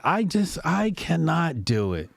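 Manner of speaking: ordinary talk, nothing marked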